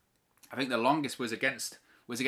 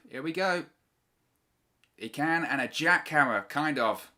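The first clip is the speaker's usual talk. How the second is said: wrestling commentator. Real, not acted. Live play-by-play of near fall.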